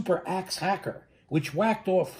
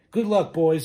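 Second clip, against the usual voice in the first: louder, sad send-off call; crying out farewell; 6 dB louder than usual. A narrator's reading. Lorax calling goodbye to his barbaloots, crying out. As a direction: call it after the departing barbaloots. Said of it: "he cried".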